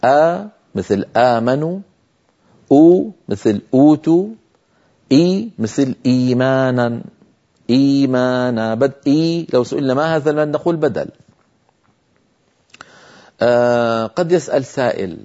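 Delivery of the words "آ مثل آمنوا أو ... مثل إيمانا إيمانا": slow, drawn-out opening vowels in آمنوا, أوتوا, إيمانا; two-count elongation. Shown as a demonstration. Teacher giving examples of elongation, opening vowels held long. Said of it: "يمد بمقدار حركتين".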